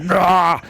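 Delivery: high, drawn-out mock roar; imitated angry roar. Jogger lunging through the bike, imitated angry roar. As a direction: imitate an enraged attacker's yell. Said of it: "making him more and more angry".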